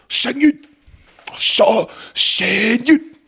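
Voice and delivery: high, sing-song "Shen Yun" like a cheesy ad; mock Shen Yun ad voice. Mocking the ad on the paper's back, high cheesy ad voice.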